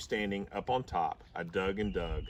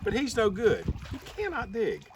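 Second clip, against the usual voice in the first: mocking dogs; 9 semitones higher than usual. A narrator's reading. Other dogs mock Duke.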